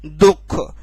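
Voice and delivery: दुख released into a breathed ha; weight the breathy end of दुख; visarga weight. Shown as a demonstration. Teacher repeating visarga demo, दुख with breathed ending. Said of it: "आप लोगों के सामने बोलूं".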